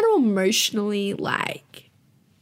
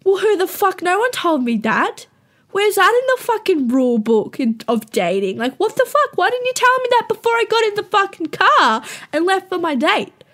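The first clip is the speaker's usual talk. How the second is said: exasperated self; 7 semitones higher than usual. Retold or imitated. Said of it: "And I was like".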